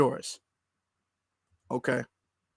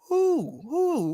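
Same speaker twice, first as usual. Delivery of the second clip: owl-like who; 8 semitones higher than usual. Shown as a demonstration. Vlogger playing dumb at a commentator, hooting like an owl. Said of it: "Get me sounding like an owl".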